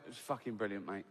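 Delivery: low and soft, bone dry; deadpan Bob. Retold or imitated. Bob to the shaken vicar after the funeral, deadpan and quiet. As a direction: say it straight to the vicar's face, no smile. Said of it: "And Bob went".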